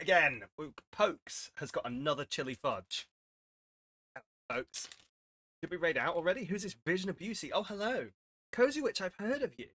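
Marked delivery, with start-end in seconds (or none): 4.2-9.7 high, bright welcome to raiders; excited greeting. Real, not acted. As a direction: greet them with delight.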